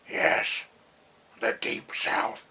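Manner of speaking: whispery, slow devil's-son voice; odd whispered character impression; Adam Sandler. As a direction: eke it out in a slow, voiceless rasp. Imitates Sandler's character in film scene.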